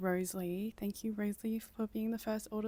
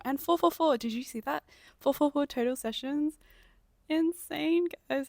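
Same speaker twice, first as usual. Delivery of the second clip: high, loud excited shouting; thrilled shout; 7 semitones higher, 10 dB louder than usual. Real, not acted. Record visitor numbers.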